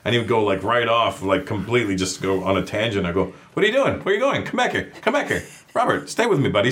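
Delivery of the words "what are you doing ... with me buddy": put-on much higher, playful coaxing to a kid; encouraging and friendly. Actor coaxing child off his tangent, high friendly call. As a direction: call him back gently, all fun. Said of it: "Very encouraging and friendly".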